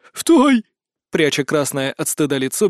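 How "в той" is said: high, yelped answer; panicked. Acted. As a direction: blurt it out in fright. Bully blurts in panic.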